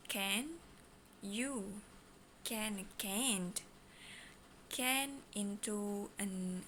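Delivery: slow, halting delivery; slow mode. Shown as a demonstration. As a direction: say each word slowly. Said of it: "Slow mode".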